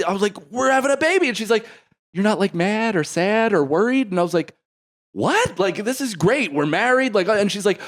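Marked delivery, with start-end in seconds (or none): 0.5-1.3 higher, fast, giddy announcement; excited rush. Retold or imitated. Joyful announcement. 2.1-4.1 higher, cautious, worried question; anxious wife. Retold or imitated. 5.1-7.1 higher, beaming "this is great"; delighted. Retold or imitated. Delighted reassurance.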